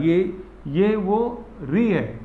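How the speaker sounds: री stretched very slowly as in ऋषि; slow teacher demo of री; drawn-out री. Shown as a demonstration. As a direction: say री long and slow, like the ऋषि sound. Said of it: "री जो अपन पढ़ते हैं ऋषि".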